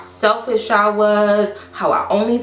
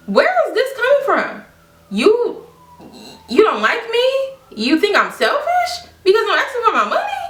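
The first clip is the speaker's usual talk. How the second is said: high-pitched, disbelieving questions; incredulous; 12 semitones higher than usual. Retold or imitated. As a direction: re-enact stunned hurt disbelief, pitch way up. Retelling disbelief at friend's accusations.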